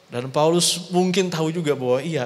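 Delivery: ordinary talk, nothing marked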